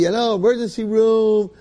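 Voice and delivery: high, loud exclamation; incredulous outburst. Acted. Patient convinced the hospital is a setup, incredulous outburst. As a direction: burst out loudly, pitched well up. He insists it's all staged.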